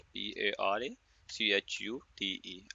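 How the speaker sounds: slow, letter by letter spelling. Shown as a demonstration. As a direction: spell each letter out slowly and separately. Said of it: "I guess spelling मैंने ठीक ही लिखे हैं". (अक्षर-अक्षर धीरे से spelling बोलते हुए)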